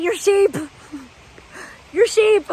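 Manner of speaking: high, loud mock sermon voice; mock preacher. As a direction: lord it over the flock, loudly. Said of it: "Patriarchal religion telling us".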